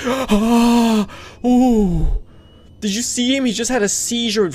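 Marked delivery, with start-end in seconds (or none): 0.1-2.2 loud gasping yelp; startled gasp. Real, not acted. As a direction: jump at the scare. Startled by Freddy.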